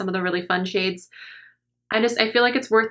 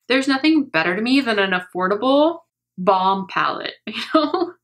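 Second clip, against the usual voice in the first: normal talking voice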